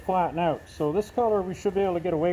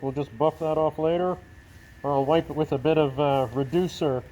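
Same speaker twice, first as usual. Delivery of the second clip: ordinary talk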